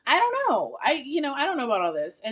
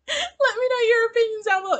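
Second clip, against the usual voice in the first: higher, laughing through the sign-off; 12 semitones higher, 4 dB louder, faster than usual. Real, not acted. Host signing off to viewers, laughing. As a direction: giggle as you sign off.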